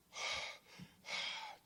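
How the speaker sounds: breathless heavy exhale; exhausted sigh. Acted. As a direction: sigh out, spent from diving. Duck surfaces out of breath.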